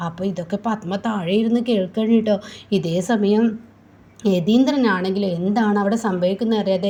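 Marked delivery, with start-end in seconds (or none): none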